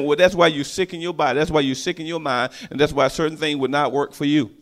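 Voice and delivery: ordinary talk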